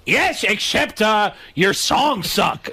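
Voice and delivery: squawky heckling comic voice; Gilbert Gottfried. Acted. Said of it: "When did you start doing Gilbert?".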